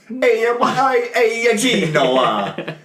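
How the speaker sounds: high, halting mock Italian accent; stumbling, high-pitched fake Italian accent; bad Italian accent. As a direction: ham up a terrible Italian-immigrant accent. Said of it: "his Italian accent's shit".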